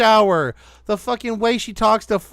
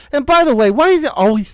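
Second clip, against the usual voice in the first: loud outburst; 4 semitones higher, 6 dB louder, faster than usual. Real, not acted. Ranting aside.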